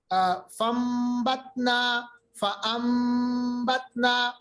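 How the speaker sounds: slow فَانبَتْنَا sounded faambatna; slow recitation with iqlab. Shown as a demonstration. Teacher recites فَانبَتْنَا slowly, n as m. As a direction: draw out فَانبَتْنَا with an m before the b. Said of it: "فَانبَتْنَا کو فَانبَتْنَا پڑھا".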